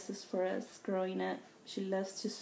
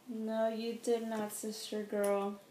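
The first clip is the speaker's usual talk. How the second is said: ordinary talk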